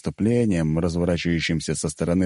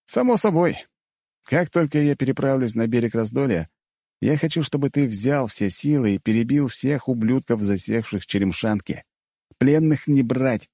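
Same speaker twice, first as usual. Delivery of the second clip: raised-pitch, firm command; giving orders; 6 semitones higher than usual. A narrator's reading. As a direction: lay down orders to a subordinate, brooking no doubt. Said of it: "приказал я". (твёрдый приказ повышенным тоном)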